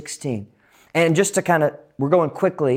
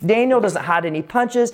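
usual speaking voice

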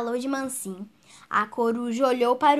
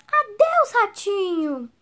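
very high, hurried fearful farewell; frightened goodbye; 8 semitones higher, 5 dB louder, slower than usual. Acted. Fox flees the gruffalo in fright. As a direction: blurt it out while running away.

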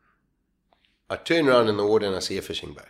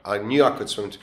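ordinary delivery

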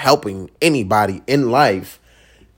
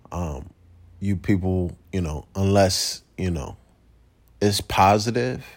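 ordinary delivery

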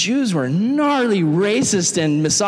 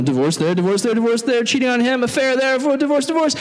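climbing higher with each item; rising list; 6 semitones higher than usual. Retold or imitated. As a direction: rattle them off faster and higher. Rattling off her history.

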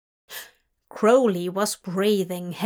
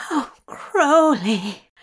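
slow, raised, drawn-out groan; 4 semitones higher, 4 dB louder, slower than usual. A narrator's reading. Angel overcome by his lover's words, groaning. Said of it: "Zira groaned".

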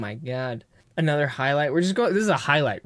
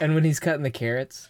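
ordinary talk, nothing marked

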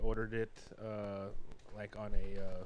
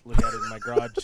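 laughing; 14 dB louder than usual. Real, not acted. Brother confessing to staring at his car naked, laughing. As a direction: voice leaping up loud into laughter.

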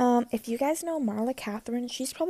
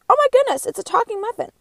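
much higher, loud mock gasp of surprise; shocked muffin; 10 semitones higher, 13 dB louder than usual. Second muffin in the joke, shrieking in amazement at the first. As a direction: squeal it like you can't believe it. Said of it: "The second muffin says".

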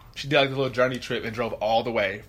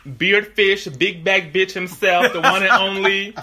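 slow, hyped guest intro; announcer voice; 5 semitones higher, 6 dB louder, much slower than usual. Acted.

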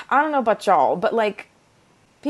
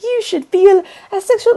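mocking preachy voice; 12 semitones higher, 7 dB louder than usual. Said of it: "it was like".

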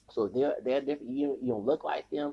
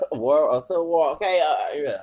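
laughing excitement; 3 semitones higher, 8 dB louder than usual. Real, not acted. Laughs at his own war joke.

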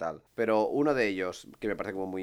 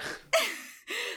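high squealing laugh. Real, not acted. Laughs at alien face joke.

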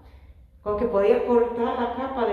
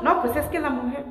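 higher, louder whiny excuse; mock Adam blaming; 5 semitones higher, 3 dB louder than usual. Preacher voicing Adam blaming Eve, higher and mocking. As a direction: play Adam pointing at the woman. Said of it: "y Adán".

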